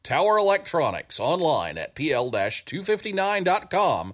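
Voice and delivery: upbeat sponsor ad voice; ad read. Acted. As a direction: read it like a radio commercial. Reads the sponsor ad.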